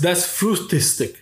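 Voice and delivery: "das Frühstück" said slowly in a German accent; German word. Shown as a demonstration. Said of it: "that's how you say it".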